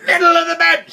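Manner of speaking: very high, very loud screech; enraged howl. A narrator's reading. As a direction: howl it, almost beyond speech. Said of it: "she howled".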